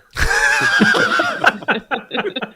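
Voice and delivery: loud high burst of laughter; big laugh. Real, not acted. Remembering saying no to customers, big loud laugh.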